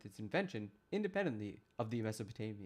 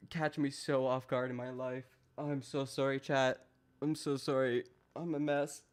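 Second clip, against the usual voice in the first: ordinary talk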